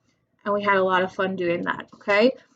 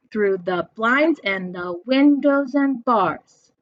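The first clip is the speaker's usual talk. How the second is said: slow three-beat scan on BLINDS, WINDOWS, BARS; sing-song meter demonstration; stressed syllables marked; slower than usual. Shown as a demonstration. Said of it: "Three stressed syllables".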